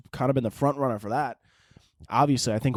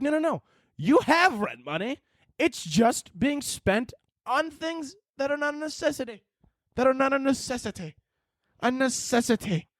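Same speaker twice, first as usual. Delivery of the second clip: exasperated lecturing; 9 semitones higher than usual. Pretend. Lecturing a friend short on rent.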